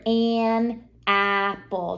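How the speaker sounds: an apple stretched very slow; drawn out. Shown as a demonstration. Teacher showing a child how the A sounds. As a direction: draw out an apple slowly. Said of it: "the A sounds very different".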